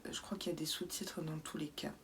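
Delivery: chuchoté vite et tout bas; glisse-le très bas, rapide; whispered aside. Pretend. Aparté taquin après l'anglais. (aparté chuchoté rapide aux spectateurs)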